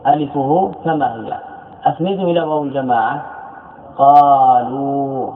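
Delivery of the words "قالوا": قالوا stretched slowly on both long vowels; very slow, lengthened قالوا; drawn-out vowels. Shown as a demonstration. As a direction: hold the alif and the final waw of قالوا. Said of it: "هل حصل تغيير؟".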